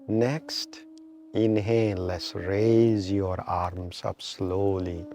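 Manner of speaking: slow, measured meditation guide with long pauses; guided meditation. Real, not acted. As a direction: draw out inhale and exhale cues to pace the breath. Leads a breathing meditation.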